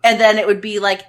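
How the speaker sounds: usual speaking voice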